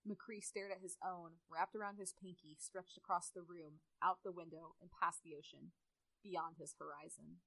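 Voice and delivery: low, soft, subdued narrator; story narration. A narrator's reading. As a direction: read the story low and quietly. Narrates the fanfiction.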